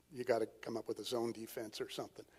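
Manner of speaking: ordinary talk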